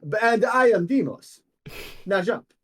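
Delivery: high, loud, self-assured hero; booming heroic introduction; Demos. Acted. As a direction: proclaim it grandly, then command. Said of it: "And he goes".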